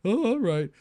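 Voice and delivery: put-on high-pitched little boy giving in; Tommy. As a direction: play a small kid grudgingly backing down.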